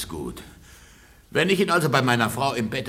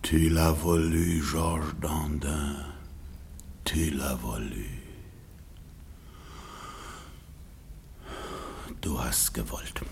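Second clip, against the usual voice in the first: low, slow whisper to himself; bitter whisper; 8 semitones lower, 11 dB quieter, slower than usual. Acted. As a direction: mutter the rebuke at yourself. Husband alone, bitter self-reproach.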